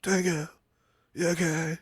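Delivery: soft, drooping mock concern; mock sad whimper. Friend parodies the sad zapped hero, soft mock sadness. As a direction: whimper it, softly pathetic. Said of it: "it's into sadness".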